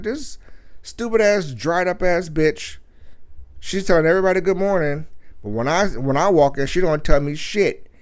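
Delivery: high, loud angry rant; retold angry rant. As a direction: let her have it, loud and high. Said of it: "I said".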